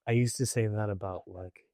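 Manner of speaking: ordinary talk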